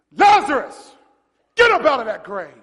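loud, forceful order falling at the end; commanding shout. Acted. Jesus calling Lazarus from the grave, shouting boldly. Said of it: "when you shout".

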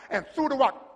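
ordinary talk, nothing marked

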